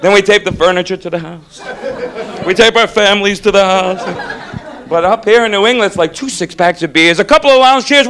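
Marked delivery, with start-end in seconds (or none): none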